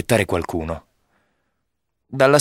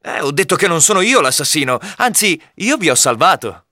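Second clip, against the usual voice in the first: high-pitched, emphatic denial; insistent protest; 6 semitones higher than usual. A narrator's reading. Robin denying he is the assassin, insistent protest. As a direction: protest your innocence, pitch raised.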